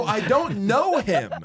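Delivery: "I don't know him" said slowly and high, each word weighted; slow furious. Retold or imitated. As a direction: drag out "I don't know him", angry and disbelieving. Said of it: "I was so furious".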